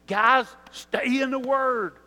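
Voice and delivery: slow, loud call to the men; emphatic urging. Retold or imitated. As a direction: press the guys to stay in the Word. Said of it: "he tells us every week".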